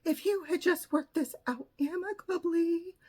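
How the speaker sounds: high-pitched mocking imitation; whiny, high mock scolding; mocked blaming therapist. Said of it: "The therapist blamed me".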